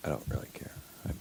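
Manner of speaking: soft whispered aside. Real, not acted. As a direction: barely breathe the words. Drops to a whisper.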